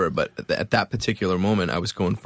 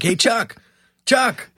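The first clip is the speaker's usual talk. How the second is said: calling out; 13 semitones higher, 4 dB louder, much slower than usual. Retold or imitated. Calling roommate through door.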